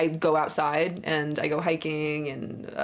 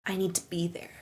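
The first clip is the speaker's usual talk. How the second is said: hushed dreamy whisper; 2 semitones lower, 7 dB quieter than usual. Pretend. Streamer whispering a dreamy craving about paddleboard yoga. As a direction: breathe it like a secret craving.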